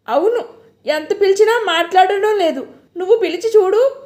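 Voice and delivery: high, hurried panic; rushing words in fear; panicked. A narrator's reading. Jayanti telling cook, high hurried panic.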